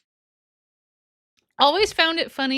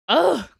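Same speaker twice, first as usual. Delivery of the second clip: loud high ugh of disgust; disgusted groan; 7 semitones higher, 5 dB louder than usual. Real, not acted.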